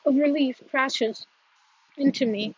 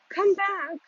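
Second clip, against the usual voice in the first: pleading cry; 7 semitones higher than usual. Acted. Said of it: "he cries".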